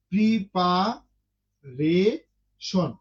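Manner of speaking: Preparation drawn out very slowly, pri-pa-re-shon; slow syllable split. Shown as a demonstration. Teacher shows the class how many parts the word has, very slow. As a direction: pull preparation apart into slow beats. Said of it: "এই যে শব্দগুলো ... উচ্চারণ করি".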